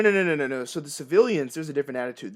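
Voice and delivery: ordinary delivery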